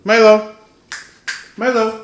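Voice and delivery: loud firm call to the dog. Real, not acted. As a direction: call out the dog's name sharply. Calling the dog again.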